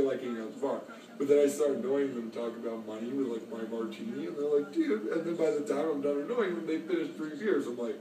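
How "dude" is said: much higher, exasperated "Dude". Retold or imitated. Fed-up drinker cutting off a pushy drunk, much higher. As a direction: push back in disbelief. Annoyed bar drinkers answering back.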